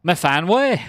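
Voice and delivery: high, loud Welsh-flavoured call of a name. Pretend. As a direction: sing the name out like a Welsh mam calling.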